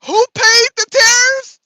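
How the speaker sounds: high, loud cry of disbelief; shocked outburst. Real, not acted. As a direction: blurt it out, high and loud, as if caught by surprise. Outraged at the clip's answer.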